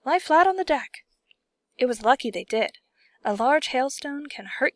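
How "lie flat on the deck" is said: high, loud urgent command; urgent cry. A narrator's reading. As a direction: warn them to take cover. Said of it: "cried James".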